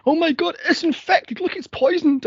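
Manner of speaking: high hammed-up panic; high mock-hysterical wail; mock hysterical mother. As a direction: shriek about a scratch like a drama queen. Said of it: "she goes to the doctor".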